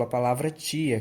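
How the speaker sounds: usual speaking voice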